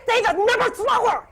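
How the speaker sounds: high, loud comic yell; mock furious shout. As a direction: yell it as if shaking the caller. Mimes shaking and strangling the caller.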